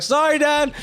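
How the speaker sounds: high-pitched mock call to dad; calling out apology. Reenacts calling back to his dad.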